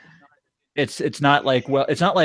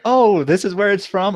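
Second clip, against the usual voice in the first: higher-pitched dawning recognition; mock realization; 6 semitones higher than usual. Viewer recognizing where a famous moment came from, mock realization. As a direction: act out the moment it clicks.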